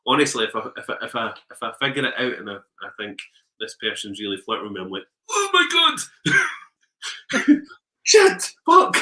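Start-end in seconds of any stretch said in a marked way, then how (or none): 5.3-9.0 much higher, loud, drawn-out alarmed cry; panicked shock. Retold or imitated. Realising someone flirts with him, mock panicked shriek. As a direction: freak out at the realisation.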